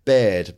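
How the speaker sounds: "Bird." said as Grimsby "bed", short open vowel, no R. Shown as a demonstration. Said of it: "if you'd say bird".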